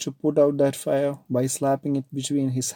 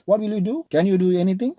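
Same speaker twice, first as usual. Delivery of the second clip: high, loud scornful challenge; angry taunt; 7 semitones higher, 5 dB louder, slower than usual. Acted. Ajit taunting his wife, loud mocking challenge. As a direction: dare her to act.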